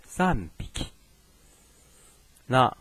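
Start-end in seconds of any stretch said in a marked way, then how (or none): 0.0-0.9 Japanese "三匹" read san-biki; "三匹" as san-biki, b after the n; voiced B, sanbiki. Shown as a demonstration. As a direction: voice the h of hiki to b in "三匹". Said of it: "那产生浊音变".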